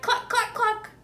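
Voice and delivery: high clucking hen voice; hen clucks. A narrator's reading. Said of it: "the hens cried".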